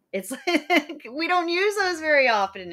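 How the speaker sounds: high-pitched amused talk through a laugh. Real, not acted. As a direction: laugh at your own slip while explaining. Laughs at own botched spondee demo.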